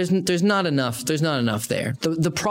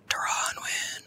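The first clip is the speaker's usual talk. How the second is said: eerie breathy whisper of a name; little voice whisper; 9 dB quieter, slower than usual. Retold or imitated. The voice in his head whispers a betting tip, whispered. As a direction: whisper it like a voice only you can hear. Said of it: "little voice in my head".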